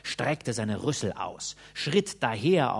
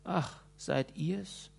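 weak voice; 4 semitones higher, 6 dB quieter, much slower than usual. A narrator's reading. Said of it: "sprach der Flohbändiger mit matter Stimme".

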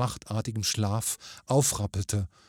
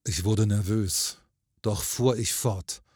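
plain reading voice

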